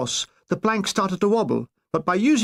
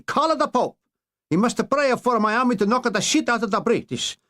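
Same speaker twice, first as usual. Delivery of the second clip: higher, hammed-up Italian command; mock Italian bluster; 6 semitones higher than usual. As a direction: bark orders in a comic Italian accent. Mussolini voiced commanding.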